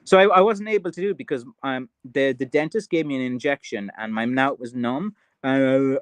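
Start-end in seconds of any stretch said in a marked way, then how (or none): none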